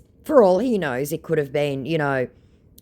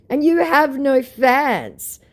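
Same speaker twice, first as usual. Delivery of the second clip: mocking voice; 12 semitones higher, 7 dB louder, slower than usual. Quoting her own retort.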